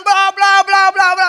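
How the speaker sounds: high, loud, drawn-out blabbering; mock frightened babble. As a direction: imitate a scared viewer's gibberish. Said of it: "wah dia ketakutan". (menirukan ocehan orang ketakutan)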